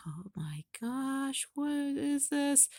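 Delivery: slow, hushed mock fright; mock scared. Voicing scared Neo before Salem, slow and hushed. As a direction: lightly act out a nervous gasp. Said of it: "Neo kinda looks scared".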